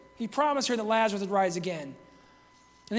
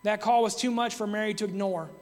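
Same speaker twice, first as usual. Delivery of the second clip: usual speaking voice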